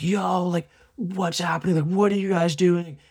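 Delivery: high, spaced-out dude voice; stoned dealer voice. Retold or imitated. Dealer asking the film crew what's up, spaced-out. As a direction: play the high drug dealer, dazed.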